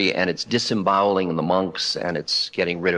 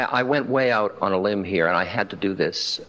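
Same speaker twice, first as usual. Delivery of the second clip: ordinary talk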